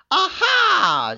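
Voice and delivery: high, loud cackling "Aha!" of a wicked witch; mocking witch cry. A narrator's reading. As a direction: crow it out in triumph like a sneering enchantress. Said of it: "she cried mockingly".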